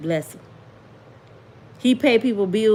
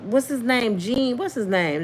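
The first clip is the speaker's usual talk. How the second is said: usual speaking voice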